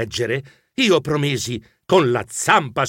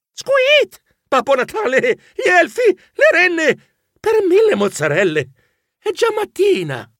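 very high, loud startled squeals; panicked squeak; 3 dB louder than usual. Acted. Mouse waking up in a panic, squealing high and loud.

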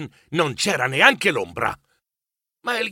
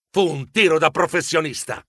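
usual speaking voice

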